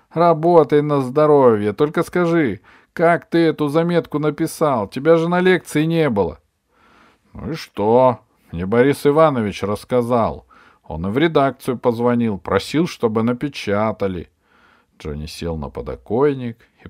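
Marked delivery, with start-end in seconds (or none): 0.1-6.4 put-on higher, louder boy shouting down from a window; a lively boy yelling his question down; loud eager boy; excited boy calling out. 7.3-14.3 put-on offhand boy. Friend answers from below.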